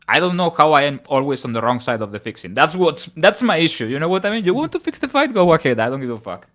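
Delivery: loud, rising to a high exasperated shout; venting fury at the judges; angry rant. Real, not acted. Ranting about fixed decisions.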